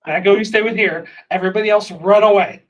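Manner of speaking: loud commands; calling out. Acted. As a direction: call it across the room.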